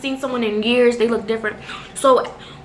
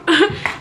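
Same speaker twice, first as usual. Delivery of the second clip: short laugh; 6 semitones higher than usual. Real, not acted. Laugh at her own eating.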